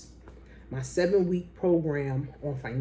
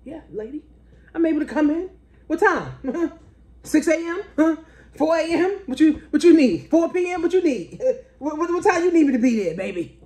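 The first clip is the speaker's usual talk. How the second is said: high, loud, rushing eager yeses to the lady; overeager, jumping at any time slot; mock eager yes; 10 semitones higher, 5 dB louder than usual. Answering the lady's call, high and overeager. Eager reply to job offer.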